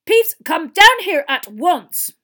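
very loud, slow, high command; angry yell. Acted. Teacher ordering the poltergeist down, angry drawn-out yell. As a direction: bellow each word as an order. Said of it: "yelled an angry voice".

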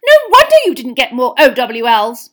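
angry mother at full blast; shrieked rebuke. A narrator's reading. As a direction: hurl the reproach in fury. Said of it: "she shrieked".

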